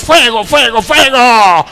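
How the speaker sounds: loud fiery preacher cry; mock preacher shouting. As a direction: shout it like a pastor whipping up the church. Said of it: "le está hablando acá".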